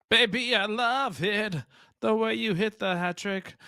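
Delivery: high, slow, swooning praise; gushing praise. Real, not acted. Commentator swooning over a smooth shot. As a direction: croon it like a smitten fan.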